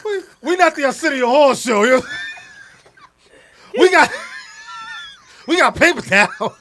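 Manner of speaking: loud, laughing joke; laughing shout. Real, not acted. Host jokes loudly about paper towels, laughing.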